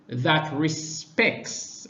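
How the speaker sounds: "respects" drawn out very slowly, each syllable weighted; stressed respects. Shown as a demonstration. Speaker to an online audience, slowly stressing "respects". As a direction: lean hard on "respects" and stretch it. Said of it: "and I emphasize the word respects".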